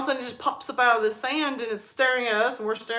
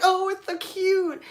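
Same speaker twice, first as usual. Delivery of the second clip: high, drawn-out mock coo; imitated cooing; 9 semitones higher, slower than usual. Everybody reacting to a little sneeze, mock cooing. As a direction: gush slowly as if over something adorable.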